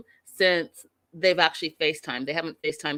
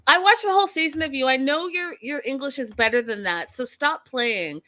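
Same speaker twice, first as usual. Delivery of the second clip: calling her out; 7 semitones higher than usual. Real, not acted. Scolding Roberta directly.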